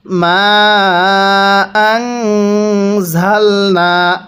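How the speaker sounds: very slow chant, nasal hum held on the nun in আংজালনা; recited with ghunnah. Shown as a demonstration. Teacher showing students the ghunnah, slow chant. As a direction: draw out মা for three alifs, then hum the nun. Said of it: "নুন সাকিনটা গুন্নাহ করে পড়ছি".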